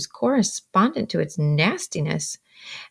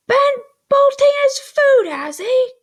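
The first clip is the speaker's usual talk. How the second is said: much higher and louder outraged cry; cried out; 8 dB louder than usual. A narrator's reading. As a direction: shriek it in outrage. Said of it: "cried my sister".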